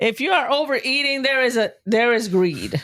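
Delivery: high-pitched, insistent scolding. Real, not acted. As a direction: push it out high, like calling out a sin. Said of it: "You don't have to scream".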